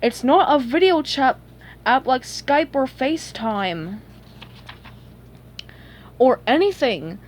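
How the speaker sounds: a touch exasperated; mild exasperation. A narrator's reading. Sage correcting two friends yelling at a window, mildly exasperated. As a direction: explain with strained patience. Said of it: "getting a little e-, exasperated".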